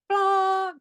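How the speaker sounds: "blah" shot very high and loud; exaggerated burst. Shown as a demonstration. Therapist showing language that breaks through distraction, high and loud. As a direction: burst it out to cut through noise. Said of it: "the language is like".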